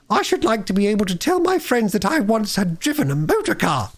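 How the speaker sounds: high, coaxing lady voice; washerwoman voice. A narrator's reading. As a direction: plead sweetly and innocently. Toad as washerwoman.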